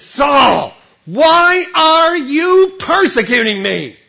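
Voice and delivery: loud, slow, high calling voice; voice from heaven. Acted. Jesus from heaven confronting Saul, loud and slow. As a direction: call down on him, slow and grave.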